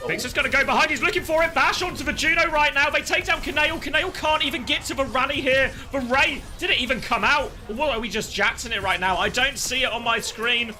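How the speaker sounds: fast, high, loud fight call; excited play-by-play. Acted. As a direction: call the teamfight live with rising hype.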